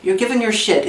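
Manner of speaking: normal talking voice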